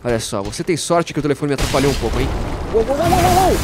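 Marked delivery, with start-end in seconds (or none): 2.7-3.6 startled. Real, not acted. Dodging boss in combat.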